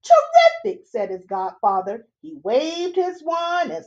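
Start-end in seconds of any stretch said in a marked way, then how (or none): 0.0-0.9 high, loud cheer; delighted. Acted. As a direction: cheer him on gleefully. Godfather delighted.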